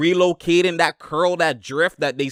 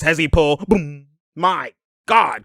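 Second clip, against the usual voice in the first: ordinary delivery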